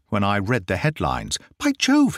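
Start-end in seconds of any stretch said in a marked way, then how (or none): 1.6-2.2 sudden high startled cry; cried out. Acted. Holmes reacting to Watson's headline, startled high cry. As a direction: blurt it out, jolted by the news. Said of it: "he cried".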